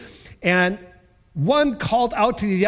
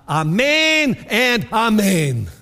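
slow solemn; 3 semitones higher, 5 dB louder, much slower than usual. Acted. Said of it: "in the Aramaic".

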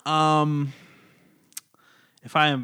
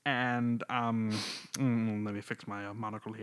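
put-on British accent; 2 semitones lower, 5 dB quieter than usual. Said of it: "should I do a British accent?".

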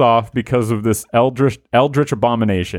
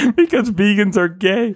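holding back laughter; 9 semitones higher than usual. Real, not acted. Cracking up reading punchline.

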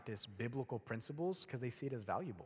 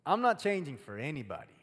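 stubborn refusal; 4 semitones higher, 8 dB louder than usual. Acted. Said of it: "do one of these and go".

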